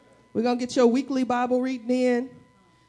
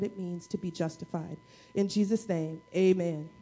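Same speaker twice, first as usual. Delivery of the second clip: softer, lower, hushed prayer; 4 semitones lower, 4 dB quieter than usual. Acted. Pastor praying for congregation before sermon, soft and hushed. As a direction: pray it gently, winding down.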